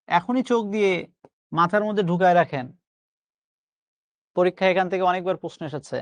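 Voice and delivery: ordinary talk, nothing marked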